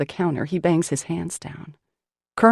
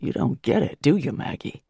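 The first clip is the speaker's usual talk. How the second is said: lower, rough growl; exhausted man growling at the woman who came to help; 3 semitones lower than usual. Acted. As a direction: growl it at her, glaring. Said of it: "Malone growls".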